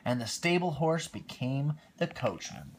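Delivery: lively read-aloud storytelling voice; storybook reading. A narrator's reading. Parent reading Cinderella to a small child, lively storytelling voice. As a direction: read it like a bedtime picture book.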